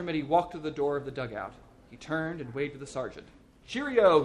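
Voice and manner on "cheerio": loud, high called-out goodbye; jaunty farewell. A narrator's reading. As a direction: sing out a brisk farewell. Hero calls goodbye leaving the dugout.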